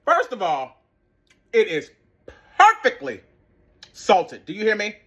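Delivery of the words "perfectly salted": perfectly flung high and loud, salted plain; overjoyed praise on perfectly; stress on perfectly. Real, not acted. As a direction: hit perfectly hard and high. Praising taste.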